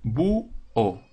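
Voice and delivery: búho said as bu-o, the two syllables kept apart; split into syllables. Shown as a demonstration. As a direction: say bu-o with a clear break between the vowels. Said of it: "Segundo ejemplo".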